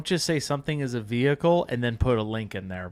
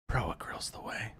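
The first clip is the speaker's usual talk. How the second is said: unvoiced sly stage whisper; 2 semitones lower, 9 dB quieter than usual. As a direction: whisper it like a secret. Brand plug whispered.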